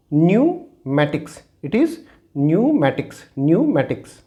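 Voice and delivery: pneumatics drawn out as numatics, no p; slow silent p demo. Shown as a demonstration. Teacher slowly showing students the silent p in pneumatics. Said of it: "it will be".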